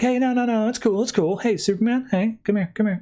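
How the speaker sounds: high-pitched, hurried-to-soothe imitation; mock cajoling, pitched well up; placating. Rao placating angry Superman.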